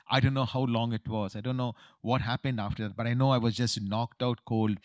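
normal talking voice

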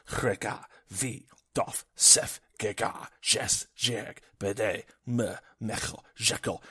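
harsh chanted string of alien names; otherworldly recitation, each word set apart; arcane incantation. Acted. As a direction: spit out strange names one by one, emphatically. Reciting eerie true names.

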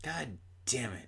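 soft whispered curse; whispering. Real, not acted. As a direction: whisper it under the breath. Whispered aside.

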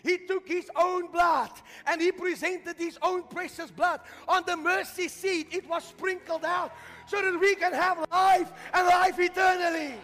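high, loud preaching building to a shout; full-force preaching. Real, not acted. Preacher proclaims resurrection and eternal life, building to full-force shout. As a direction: drive it up to a shouted climax.

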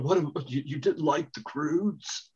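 halting impersonation, dragged out at the end; Nicolas Cage. Acted. Podcaster plays the slighted actor questioning a list, halting impression. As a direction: stammer into a slow, put-on incredulous question. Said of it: "a pretty good impersonation".

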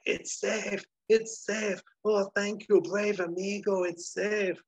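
high-pitched, gushing bandit gratitude; overjoyed relief. Acted. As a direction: cry out in hammed-up relief at a rescued treasure. Bandit finds his lost treasure intact.

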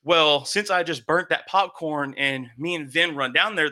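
ordinary talk, nothing marked

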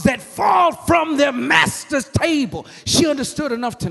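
high, loud, sung shouting cadence; whooping preaching. Acted. Preacher whooping the woman's answer to Jesus, loud and sung. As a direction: chant and shout it home.

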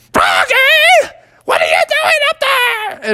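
shrieky falsetto cartoon mother; alarmed mom. Mom spots her child in the sky, high shriek. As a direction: screech up at the child. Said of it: "Froggy's mom said".

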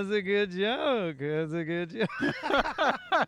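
lightly voiced famous comic, slowly approving; polite famous comic. Telling hosts how Garry Shandling praised his joke, slow and mild. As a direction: stretch it out like a gracious nod. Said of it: "smiles and nods and he goes".

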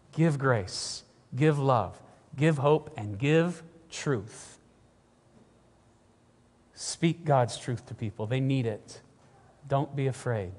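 soft, low and measured; hushed earnest urging. Real, not acted. Preacher urging people to give grace, hushed and earnest. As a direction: drop low and quiet, letting each appeal settle.

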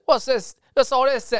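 put-on high-pitched, botched Australian accent; bad Australian accent. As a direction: attempt an Australian accent and miss. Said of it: "don't know how to do the Australian accent".

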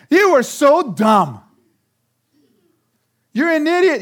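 slow, loud mock scorn; mock insult. Acting a spouse insulting the other, slow and loud. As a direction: drag out the insult.

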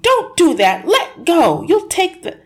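high, loud, frantic protest; panicked shouting. Acted. Husband shouting at wife to stop pulling his hat. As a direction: yell at her to let go. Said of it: "he screamed".

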